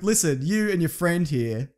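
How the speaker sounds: ordinary talk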